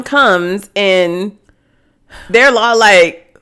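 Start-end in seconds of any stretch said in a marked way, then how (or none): none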